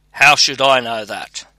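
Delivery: usual speaking voice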